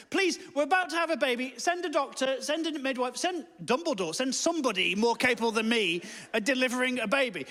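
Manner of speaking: high-pitched pleading down the phone; acted panic. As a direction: beg urgently for help, acting panicked. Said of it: "I'm panicking at this point".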